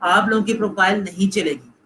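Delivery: ordinary talk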